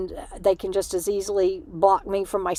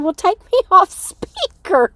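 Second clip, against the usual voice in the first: high, loud quote through laughter; laughing retold reply; 11 semitones higher, 8 dB louder than usual. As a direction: tell it laughing, voice pitched up. Laughing retelling of own reply.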